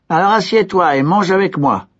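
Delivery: voix haute et amicale; idiot généreux. A narrator's reading. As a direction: inviter avec candeur. L'idiot invite. (l'idiot invite le serviteur à table)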